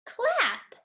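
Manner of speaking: "Clap" said high and bright; cheerful answer. Shown as a demonstration. Teacher to pupils, bright high reveal of the word. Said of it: "What's the word?".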